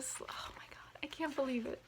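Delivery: whispered oh my God; hushed amazement at the unwrapping; awed whisper. Real, not acted. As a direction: breathe it out in awe. Unwrapping, overwhelmed.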